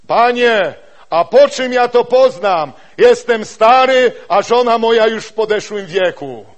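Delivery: put-on loud, high, slow plea of an old man; doubting old Zechariah. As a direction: protest your age to the angel. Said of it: "to mówi". (głośny, wysoki protest starego Zachariasza)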